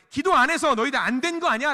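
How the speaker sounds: high, forceful telling-off; mock scolding. Imagined Jesus blaming disciples for not praying, scolding. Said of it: "혼내셨다라고 한다면".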